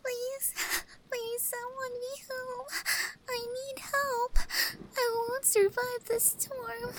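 high, soft and crying, begging at the door; small, desperate weeping in the rain; tearful pleading. Acted. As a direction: sob out a plea for shelter, voice breaking. Stray cat girl caught in a storm.

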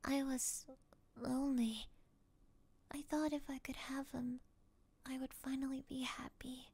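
soft, broken confession; ashamed whisper. Acted. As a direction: barely breathe the confession. Captor confesses under hypnosis.

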